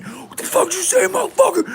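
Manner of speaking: high, loud enraged shout; furious yell. Retold or imitated. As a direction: burst back into the room roaring. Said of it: "Scotty comes back in".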